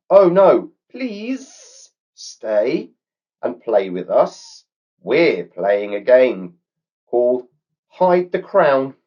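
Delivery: eager snake coaxing, slow on the game name; snake pleading. A narrator's reading. Snake begging Brooke to stay and play, high eager snake voice. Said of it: "Simon quickly responded with".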